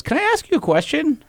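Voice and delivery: lady passer-by. Retold or imitated. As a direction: ask it like a curious neighbour. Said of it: "She goes".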